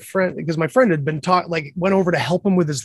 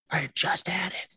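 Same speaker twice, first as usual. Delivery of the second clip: hushed, whispery disbelief; awed hush; 2 semitones higher, 9 dB quieter than usual. Real, not acted. Telling friend the lighter was right there, awed hush. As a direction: drop almost to a whisper.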